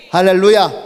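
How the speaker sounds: loud, ringing praise call; hallelujah shout. Real, not acted. Preacher shouts hallelujah to the congregation, loud and ringing.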